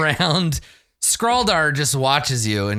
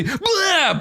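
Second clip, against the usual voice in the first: sudden high-pitched "blah" of spitting poison; imitated spray noise. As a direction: blurt out a mock poison spit.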